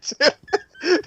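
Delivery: ordinary delivery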